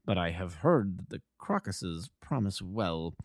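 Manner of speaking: soft, slow, unruffled; imperturbably. A narrator's reading. Holmes calmly chatting about crocuses. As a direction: stay serenely calm. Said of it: "continued my companion imperturbably".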